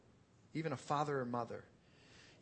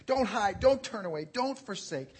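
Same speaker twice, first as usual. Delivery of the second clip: raised, urgent pleading in prayer; acted fearful plea to God; fearful praying; 6 semitones higher, 9 dB louder than usual. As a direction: plead it out loud and high, as acted fear. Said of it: "the psalmist is fearfully praying".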